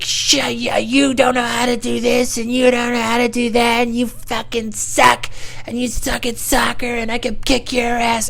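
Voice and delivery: very high, slow bratty voice; squeaky, drawn-out playground trash talk; taunting neighborhood kid. Retold or imitated. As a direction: rant like a whiny little boy. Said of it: "the kid is, like, saying things".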